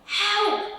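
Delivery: high, loud frightened cry; cry for help. Acted. Animals flee the mole, high frightened cry. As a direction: squeal for help. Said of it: "cried the animals".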